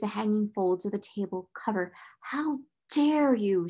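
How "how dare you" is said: slow, raised-pitch outrage; indignant cry. A narrator's reading. Headmistress finding the maid hidden under the table, outraged. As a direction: draw out the indignation. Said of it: "she cried out".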